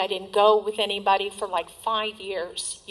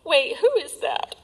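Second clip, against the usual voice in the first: surprised; 7 semitones higher than usual. Retold or imitated. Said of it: "and I went".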